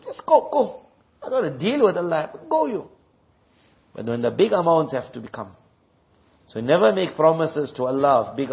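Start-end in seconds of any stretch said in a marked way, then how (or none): 0.1-2.9 high, far-off brushing-off voice; dismissive rich man. Retold or imitated. Rich man waves off the poor man, high and dismissive. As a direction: shoo the beggar away, much higher.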